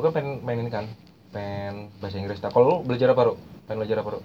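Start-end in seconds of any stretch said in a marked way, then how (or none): none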